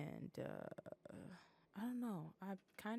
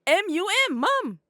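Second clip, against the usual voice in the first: loud, higher, spelling it out; shouted spelling; 12 semitones higher, 17 dB louder than usual. Shown as a demonstration. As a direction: belt out each letter, then the word. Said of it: "Why you deliver it like that?".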